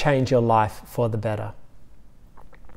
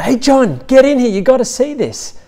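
much higher, louder call across the tomb; 11 semitones higher, 5 dB louder than usual. Retold or imitated. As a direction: shout for him to come see. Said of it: "calls out a bit louder".

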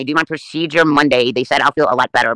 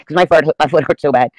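ordinary talk, nothing marked

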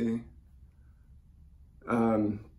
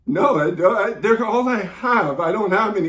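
loud, higher-pitched desperate insistence; distraught protest; 6 semitones higher, 6 dB louder than usual. Acted. Child told both parents died, distraught protest. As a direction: protest in rising panic.

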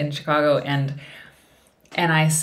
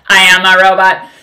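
blurted out very loud; loud robot announcement; 3 semitones higher, 15 dB louder than usual. Retold or imitated. Blurting to strangers in a lobby, very loud. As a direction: declare it loudly to the lobby. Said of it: "quite loudly".

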